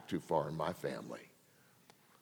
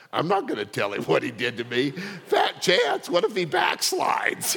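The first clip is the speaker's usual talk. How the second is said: higher, louder comic inner voice; mock defiant shout; 6 semitones higher, 8 dB louder than usual. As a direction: ham up the stubborn thought.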